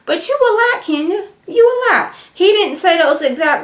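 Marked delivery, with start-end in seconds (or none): 0.1-2.1 squeaky high exasperated whine. Retold or imitated. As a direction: throw up hands, voice cracking high. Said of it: "I guess he's like".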